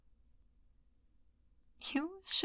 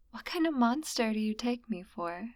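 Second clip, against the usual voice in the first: ordinary talk, nothing marked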